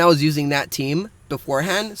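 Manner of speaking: ordinary delivery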